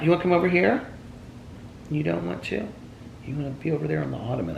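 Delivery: high, soft pet-talk voice; soft high coo, questioning; coaxing a pet. Real, not acted. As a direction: coax gently in a high little voice. Coaxing pet to come over.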